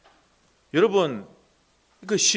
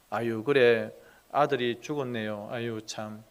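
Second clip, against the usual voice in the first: soft, hollow tut-tut of pity; mock sighing sympathy; 6 semitones lower, 5 dB quieter than usual. Preacher mocking a shallow mourner with a soft sigh. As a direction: sigh it out like an empty condolence.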